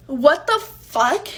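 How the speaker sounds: slow, loud, drawn-out "What the fuck?"; stunned disbelief. Real, not acted. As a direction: let "What the fuck?" stretch out in shock. Shocked at finding the messages.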